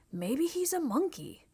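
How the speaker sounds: put-on light kid voice for Michael; Michael's voice. A narrator's reading.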